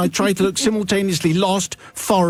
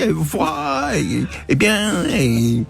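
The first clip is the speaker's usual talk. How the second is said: mock French, self-admiring; muttered French phrases; slower than usual. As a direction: reel off French phrases in a put-on accent. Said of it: "various French phrases under my breath".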